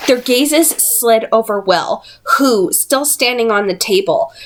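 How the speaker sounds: ordinary delivery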